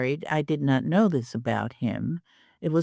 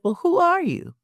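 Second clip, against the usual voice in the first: curious challenge; 12 semitones higher than usual. Retold or imitated.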